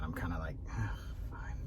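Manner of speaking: reluctant whisper; whispered grudging. Real, not acted. Dad reluctantly agreeing to play, whispered. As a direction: sigh the words out under your breath.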